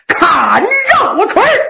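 high, slow shouted call; battle cry. Acted. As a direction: roar it high and drawn out. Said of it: "嘴里在喊".

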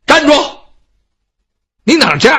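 shout. Acted. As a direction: halt him with a sharp yell. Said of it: "在后边就喊了一嗓子".

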